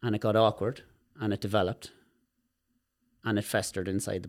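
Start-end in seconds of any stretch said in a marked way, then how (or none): none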